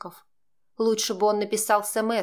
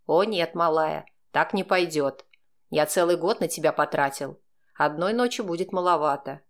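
ordinary delivery, nothing marked

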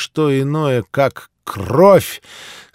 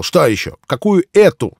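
sharp retort; 6 semitones higher, 6 dB louder than usual. Acted. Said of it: "и я резко бросил".

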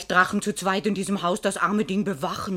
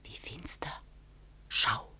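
fearful whisper; 9 semitones lower, 13 dB quieter, slower than usual. Acted. Maid whispers warning that master returns, whisper. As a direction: whisper so he won't hear.

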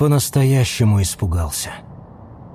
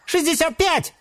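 tiny high squeak; 3 dB quieter than usual. A narrator's reading. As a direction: squeak it like a small animal. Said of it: "пискнул один из мангустов".

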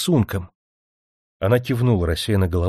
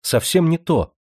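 hasty indignant denial; 8 semitones higher than usual. A narrator's reading. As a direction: cut him off, indignant. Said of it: "отвечала она торопливо, с негодованием".

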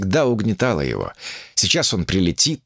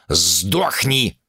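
ordinary delivery

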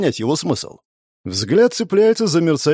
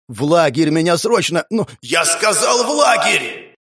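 desperate command; 3 semitones higher than usual. Acted. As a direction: demand it through pain. Hero orders, dying.

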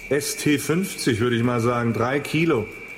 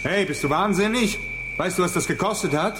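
indignant outburst; 5 semitones higher than usual. Acted. His phone thrown into field.

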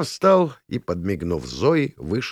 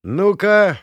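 raised, loud prompt to the room; calling out; 12 semitones higher, 6 dB louder than usual. A narrator's reading. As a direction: call the group to attention, high and loud. Юрка зовёт компанию отгадывать. (задорный громкий оклик)

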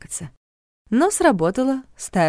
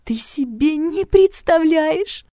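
dreamy gushing; 10 semitones higher, 4 dB louder, much slower than usual. A narrator's reading.

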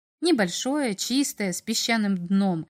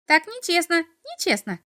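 rattling off; 9 semitones higher than usual. A narrator's reading. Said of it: "затараторил чертёнок".

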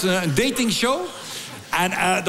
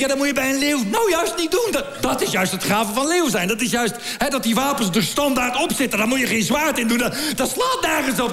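high-pitched comic indignation; worked-up, incredulous tirade; exasperated rant; 4 semitones higher than usual. Acted. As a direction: rant in mounting disbelief at the absurdity. Comic rant about lions on passport.

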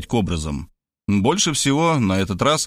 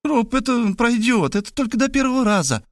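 put-on coaxing young woman; 12 semitones higher than usual. A narrator's reading. Girl coaxing during the chase. (высокий девичий голос, уговаривает)

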